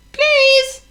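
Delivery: very high pleading squeak; squeaky plea. Acted. Mouse begs Hippo, high squeaky please. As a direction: beg sweetly.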